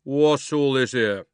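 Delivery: loud, slow constable's question; slow official policeman. A narrator's reading. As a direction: weigh each word with authority. Policeman asks.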